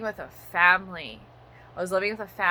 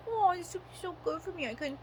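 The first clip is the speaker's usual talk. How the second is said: mock dreamy self; 6 semitones higher, 8 dB quieter, faster than usual. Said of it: "I'm like".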